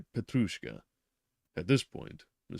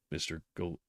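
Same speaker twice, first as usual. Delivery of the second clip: ordinary delivery, nothing marked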